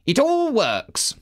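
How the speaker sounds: a dutiful steward defending his work; Dusty the steward. A narrator's reading. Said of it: "he said".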